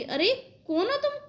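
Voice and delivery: put-on high, urgent call after fleeing boys; calling out at thieves. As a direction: shout after them, demanding who they are. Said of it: "मैं कह रही".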